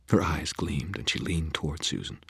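plain reading voice

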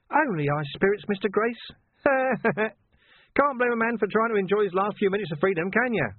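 high, laughing taunting voice; mock him with a chuckle; cheeky villain. A narrator's reading.

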